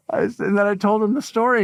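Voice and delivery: usual speaking voice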